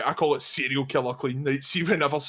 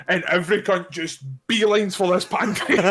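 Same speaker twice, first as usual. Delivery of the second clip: normal talking voice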